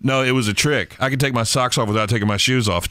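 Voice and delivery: ordinary talk, nothing marked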